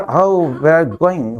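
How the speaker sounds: "going" said slowly in full English; slow corrected English. Shown as a demonstration. Teacher showing the corrected sentence, very slow. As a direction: stretch out "where are you going". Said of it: "अगली बार".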